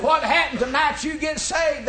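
retold preacher, shouted; old preacher's shout. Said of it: "And he said".

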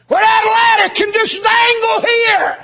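high, loud, drawn-out preacher's cry; preaching at full force. Acted. Preacher crying out the vision to his congregation, full-force shout. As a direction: whoop the line out to the congregation.

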